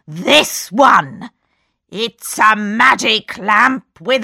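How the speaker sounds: slow, sly villain; gloating magician; scheming magician. A narrator's reading. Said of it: "he said".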